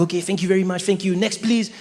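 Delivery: put-on young French clerk serving customers; brisk French-accented politeness. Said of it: "very French, cool guy".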